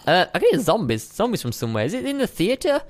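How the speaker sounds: normal talking voice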